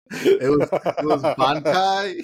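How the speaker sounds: high laugh, then slow drawn-out words. Real, not acted. Fan laughing to cohosts about the too-quick Bankai fight, laughing slowly. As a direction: laugh through it, stretching Bankai.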